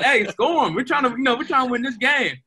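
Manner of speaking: mock cheering the runner on to win; excited urging. His retold cheer to runner.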